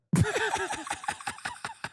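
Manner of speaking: very high, soft cackle; real high-pitched giggling fit; incredulous laughter. Real, not acted. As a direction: crack up in a thin high laugh. Laughs at the clip's claim.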